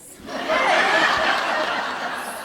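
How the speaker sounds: high, loud laugh out of nowhere; embarrassed burst of laughter. Real, not acted. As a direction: crack up laughing. Laughing at her own journal.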